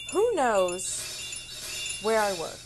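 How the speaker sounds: loud, drawn-out alarmed demand; emphatic, slow mock panic; retold freak-out. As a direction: stretch it out, freaking out as you retell it. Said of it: "I was kind of freaking out".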